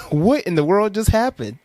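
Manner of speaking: laughing mock outrage; mock shocked mom. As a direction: play the mom aghast at the refusal.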